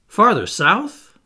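high, loud and slow in disbelief; astonished. A narrator's reading. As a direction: gape at the idea as you ask. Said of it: "I asked, gaping at the captain".

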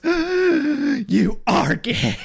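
slow high astonished gasp; hammed-up stunned realization; mock gasping shock. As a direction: drag it out in high shocked disbelief. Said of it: "he goes".

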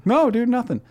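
high-pitched innocent denial; mock-innocent protest, pitch jumping up; feigned innocence. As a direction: deny it like you're playing dumb. Feigns innocence to suspicious coworker.

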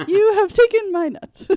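high squeaky squirrel voice; rubbery high squeaky toy. Retold or imitated. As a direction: squeak like a tiny cartoon squirrel. Voicing the squirrel from the movie.

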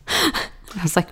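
ordinary talk, nothing marked

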